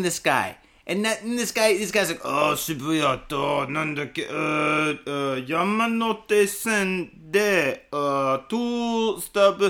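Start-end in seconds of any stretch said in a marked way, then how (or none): 2.2-9.6 put-on slow, halting Japanese accent English; a Japanese businessman fumbling directions in a Japanese accent; bad Japanese accent, slow and hesitant. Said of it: "my accent's terrible".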